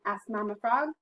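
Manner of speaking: plain reading voice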